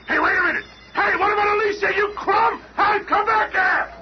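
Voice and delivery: angry shout. Acted. Ben shouting after escaping Doom, furious shout. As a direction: yell after him as he escapes.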